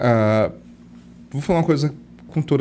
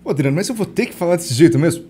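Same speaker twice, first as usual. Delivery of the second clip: lightly imitated protesting boss, pitched up; protesting leader; 5 semitones higher, 4 dB louder than usual. Company leader questions his coach, mock protest. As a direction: object a little louder and higher. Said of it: "tem um líder de uma grande empresa".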